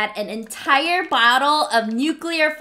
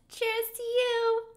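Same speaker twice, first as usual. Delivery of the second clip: soft cute toast; 11 semitones higher, 6 dB quieter than usual. Real, not acted. Toast thanking the host.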